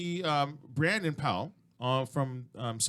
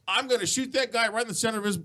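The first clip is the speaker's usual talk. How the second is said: raised voice, quick build to a big BOOM; retold bold boast; 6 semitones higher, 5 dB louder than usual. Host retells a shooter calling her shot, mock boast. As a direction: act out her called shot, exploding on the last word.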